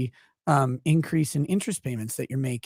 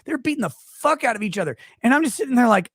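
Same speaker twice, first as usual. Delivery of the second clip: usual speaking voice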